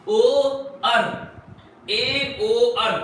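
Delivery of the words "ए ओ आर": slow drill. Shown as a demonstration. Teacher making students memorise the trick, very slow. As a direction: spell out ए, ओ, आर one by one slowly. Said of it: "इसमें एकदम बिल्कुल ध्यान रखेंगे".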